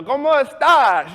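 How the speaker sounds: loud, high, slow Spanish greeting; overconfident, stretched-out greeting; showing off. Retold or imitated. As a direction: belt it out to impress. Shows off greeting to customer.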